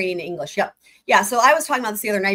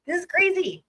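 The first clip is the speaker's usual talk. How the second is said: much higher, disbelieving exclaim; incredulous; 10 semitones higher than usual. Real, not acted. Reacting to the filing with high incredulous surprise. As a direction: pitch it up in sheer disbelief.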